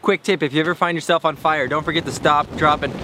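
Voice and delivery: ordinary talk, nothing marked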